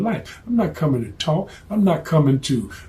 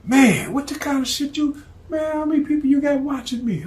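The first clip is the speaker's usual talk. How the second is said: rattled target; 11 semitones higher than usual. Acted. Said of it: "He said".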